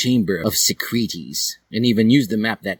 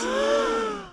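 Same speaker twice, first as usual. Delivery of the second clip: shocked gasp; 4 dB quieter than usual. Acted. Shocked reaction to confession.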